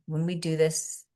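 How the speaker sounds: soft, quiet meditation guidance; guided meditation. Acted. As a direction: ease the group into stillness softly.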